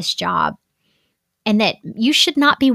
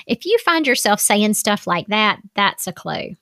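normal talking voice

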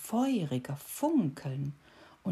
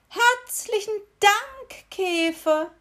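much higher, louder, slow tearful thanks; sobbing thanks; 8 dB louder, slower than usual. A narrator's reading. Beetle sobbing after the departing cricket, high and tearful. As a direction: sob out the thanks. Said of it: "den Käfer noch hinter sich schluchzen".

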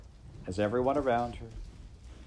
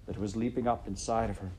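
plain reading voice

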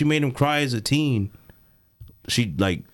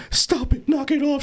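much higher-pitched plea to stop; mock protesting girl; 14 semitones higher than usual. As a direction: imitate a flustered girl protesting. Acts out bullied girl's protest.

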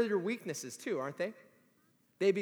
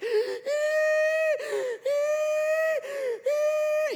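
loud high-pitched mock wheezing gasps; exaggerated squealing wheeze; wheezing cry; 7 dB louder than usual. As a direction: imitate a winded kid crying and gasping for air. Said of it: "he's wheezing and he's crying".

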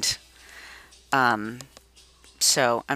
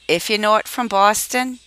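Boston accent; 8 semitones higher, 4 dB louder than usual. Shown as a demonstration. Demonstrating her Boston accent.

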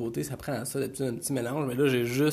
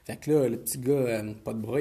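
ordinary delivery

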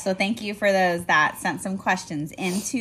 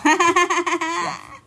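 loud laugh; 11 semitones higher, 7 dB louder than usual. Real, not acted.

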